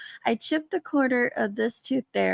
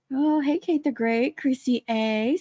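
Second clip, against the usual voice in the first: normal talking voice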